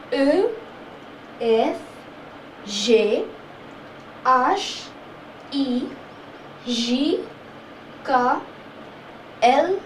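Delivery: each letter spaced out, the French way; French alphabet. Shown as a demonstration. Teacher reciting the French alphabet for learners. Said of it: "we are gonna do the French alphabets".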